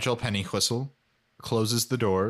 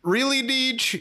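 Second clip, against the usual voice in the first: exasperated; 12 semitones higher than usual. Real, not acted. Mocking the character's obliviousness.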